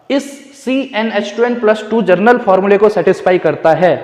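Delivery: ordinary delivery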